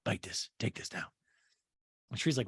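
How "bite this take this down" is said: low, soft pusher whisper-talk; mock drug dealer offering an edible; hushed dealer voice. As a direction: murmur it low like selling on a corner. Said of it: "She's like".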